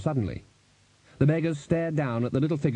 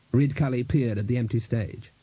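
usual speaking voice

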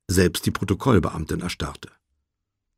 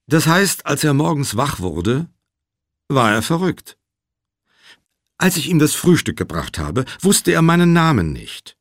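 higher, louder, insistent testimony; slightly agitated witness, pitched up; lightly voiced pressed witness; 6 semitones higher, 4 dB louder than usual. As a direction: press your account on the questioner. Voices the witness Sneed answering.

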